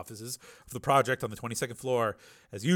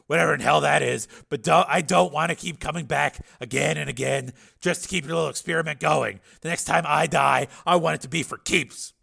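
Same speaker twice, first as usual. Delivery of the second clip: raised, higher, pressing argument; Steele, agitated; 5 semitones higher, 7 dB louder than usual. A narrator's reading. Patient imagines his mind in another body, loud and agitated. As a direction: build to a loud indignant question.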